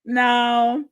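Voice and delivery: ordinary talk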